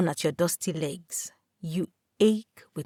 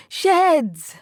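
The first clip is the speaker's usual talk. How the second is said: higher and louder call after him; 9 semitones higher, 7 dB louder than usual. A narrator's reading. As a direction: call him back as he walks off. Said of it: "You call out to him".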